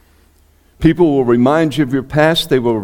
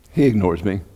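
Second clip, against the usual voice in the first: usual speaking voice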